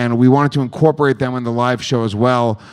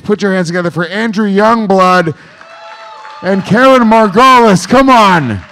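higher and louder, rising to a big announcer shout; hype announcer call; 6 semitones higher, 6 dB louder than usual. Real, not acted. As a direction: pump up the crowd as the guests walk on. Host introducing guests onstage.

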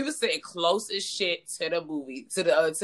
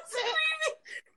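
very high, shrieking cry of laughter; 5 dB quieter than usual. Real, not acted. [name] hearing her Popeyes story told, shrieking with laughter. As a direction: let out a helpless screaming laugh. Explodes laughing at being teased.

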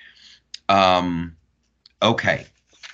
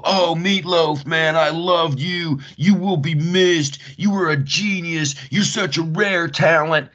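higher, slow, hammed-up sappy tribute; mock mourners; 10 semitones higher, 4 dB louder than usual. Mocking fans who post tributes but never bought tickets, sappy and higher. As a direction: parody the gushing online eulogy. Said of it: "All these frickin' people".

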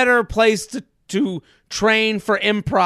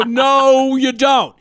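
mock insistence; 4 semitones higher, 4 dB louder, much slower than usual. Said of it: "they would go".

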